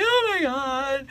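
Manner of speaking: high, drawn-out starstruck gasp; fangirling. Retold or imitated. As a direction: stretch it out in fan awe. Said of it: "I was all hashtag fangirling".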